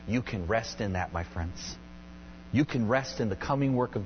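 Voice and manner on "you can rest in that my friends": soft reassurance. Real, not acted. Preacher gently reassures congregation.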